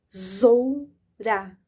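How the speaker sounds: "Tesoura" very slow, syllables pulled apart; slow sounding out. Shown as a demonstration. Teacher stretching "Tesoura" to show the vowels. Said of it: "Olha aqui a vogal E e a vogal O".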